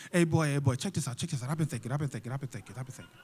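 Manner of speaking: low, soft, repetitive pimp patter; seventies pimp. Acted. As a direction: mumble it like an old-school hustler. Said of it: "seventies pimp dialogue".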